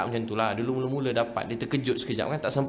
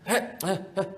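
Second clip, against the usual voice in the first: short, high mock grunts; cat-shooing noise; 5 semitones higher than usual. Gruff father calling son like shooing a cat, high grunts. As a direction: shoo someone off with curt wordless noises. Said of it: "dia macam halau kucing je".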